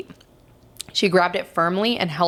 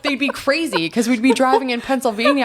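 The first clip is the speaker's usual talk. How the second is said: ordinary talk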